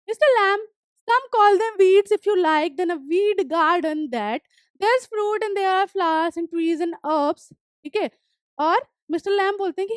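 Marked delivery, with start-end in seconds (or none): 0.1-7.5 reading the story text slowly, word by word; slow reading aloud. Acted. Teacher reading the story aloud to students, slow and clear. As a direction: read each English line slowly and clearly for the class.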